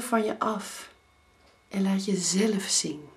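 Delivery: measured poem reading with long pauses between lines; poem recitation. Acted. Coach reciting her own poem on letting go to listeners, measured poem recitation. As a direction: recite it line by line, letting each phrase land.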